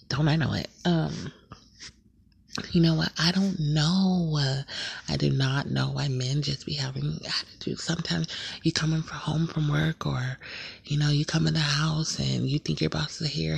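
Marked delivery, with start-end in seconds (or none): none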